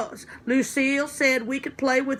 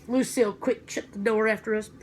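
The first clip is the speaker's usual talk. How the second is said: usual speaking voice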